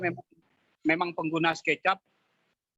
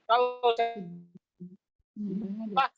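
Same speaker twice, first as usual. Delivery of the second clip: choppy audio cutting out; radio breaking up; 2 semitones higher than usual. Real, not acted. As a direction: voice dropping out. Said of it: "Putus-putus".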